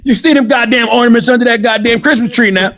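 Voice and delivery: high-pitched country hollering, played for laughs; laughing, hammed-up country voice; country accent. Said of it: "Why is it always gotta go to country, too, though?".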